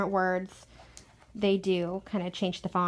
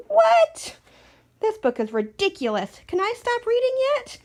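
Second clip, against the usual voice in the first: much higher, louder indignant cry; mock outrage; 7 dB louder than usual. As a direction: play the outraged grown-up who wants to stop reading. Reader acting outraged by the book.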